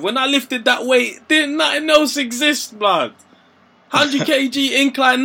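hyped-up recall. Retold or imitated. Friend hyping his gym lift to his mate, slow and high. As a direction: relive the heavy lift with slow pumped-up pride. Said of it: "I was in that moment".

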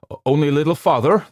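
loud, fast encouragement; urgent encouragement. A narrator's reading. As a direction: urge her the last steps. Doctor urging her.

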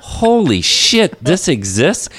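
high, slow stunned Holy shit; drawn-out amazed gasp of words; mock shock at finding old magazines; retold disbelief. Recalling shock at porn rack.

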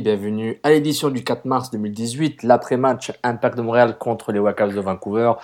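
loud, bright podcast opening; show host welcome. Acted. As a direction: announce the show with energy. Host's show opening announcement. (animateur qui ouvre l'émission avec entrain)

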